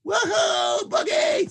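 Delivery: very high whooping shout; wild boogie shout. A narrator's reading. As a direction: let loose and whoop like a party bird. Bird allowed to boogie.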